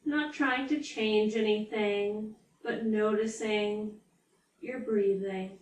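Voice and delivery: unhurried yoga nidra guide, leaving space after each phrase; guided meditation. Real, not acted. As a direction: ease them into stillness, drawing out key words.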